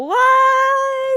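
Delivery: high, loud excited "What?"; excited squeal. Acted. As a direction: squeal it out in delight. Excited reveal to cohost.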